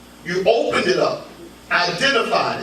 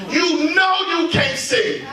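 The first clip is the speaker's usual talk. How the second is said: preaching build; 8 semitones higher than usual. Acted. Preaching, pitch up.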